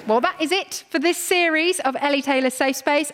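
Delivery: normal talking voice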